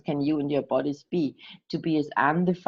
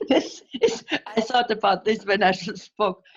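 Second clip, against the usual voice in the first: real giggles breaking into high-pitched words; laughing while speaking; 4 semitones higher than usual. Real, not acted. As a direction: try to talk through a laughing fit. Interpreter cracks up mid-sentence. (lachend sprechen)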